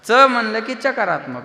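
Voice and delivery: loud, ringing kirtan sermon voice; a kirtankar thundering at the gathering; full-force preaching. Acted. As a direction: preach it out to the whole crowd.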